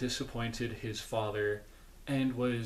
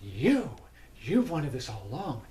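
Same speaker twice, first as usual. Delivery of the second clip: lightly acted royal accusation; Red Queen; 3 semitones higher than usual. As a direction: launch into it as a wronged queen. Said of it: "the Red Queen's like".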